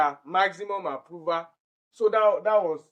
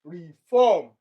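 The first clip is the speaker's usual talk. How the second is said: "Reform" with a loud FORM; stress on second syllable; 7 dB louder than usual. Shown as a demonstration. Teacher demonstrates stress pattern, loud second syllable. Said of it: "the one that has the stress pattern".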